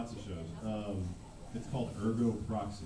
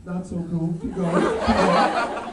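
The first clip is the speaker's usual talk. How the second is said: laughing, rising to louder and higher; mock eager; 7 semitones higher, 10 dB louder, slower than usual. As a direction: push him on with growing glee. Retold reaction, building eagerness.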